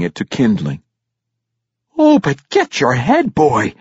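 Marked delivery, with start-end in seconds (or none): 2.0-3.7 high, loud motherly scolding; scandalized dwarf mother scolding her son. A narrator's reading. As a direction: tell off the boy in a raised pitch. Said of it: "Yuene scolded".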